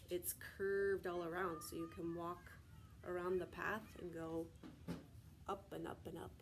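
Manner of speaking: ordinary talk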